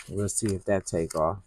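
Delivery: ordinary talk, nothing marked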